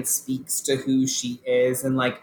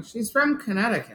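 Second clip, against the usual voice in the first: high, emotionless imitation of Erica; deadpan impression; 9 semitones higher than usual. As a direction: say it with zero emotion, pitched up. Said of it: "with no emotion going".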